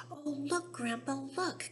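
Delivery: put-on high, thrilled child's call; excited cry. Charlie calling Grandpa to the squirrels, high and excited. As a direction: point and gasp with delight. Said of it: "cried Charlie".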